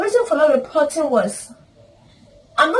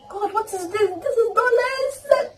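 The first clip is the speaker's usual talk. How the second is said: higher and slow, stunned; disbelief; 9 semitones higher, 4 dB quieter than usual. Retold or imitated. Staring at the money, she asks God in disbelief. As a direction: draw it out as if you can't believe it.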